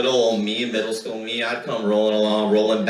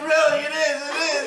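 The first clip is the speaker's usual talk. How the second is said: high, strained giving up; pinned little brother pleads weakness; whiny plea. Retold or imitated. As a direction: whimper that this is all he's got. Admitting he can't fight back.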